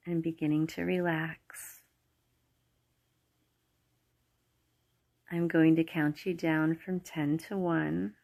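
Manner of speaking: calm, unhurried meditation voice with long pauses; guided meditation. Acted. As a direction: guide the listener gently into relaxation. Leads a guided relaxation meditation.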